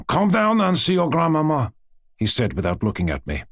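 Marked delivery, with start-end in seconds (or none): 0.1-1.7 higher-pitched official summons; severe, official. A narrator's reading. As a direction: order them down with a stiff official air. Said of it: "entered with a severe official air".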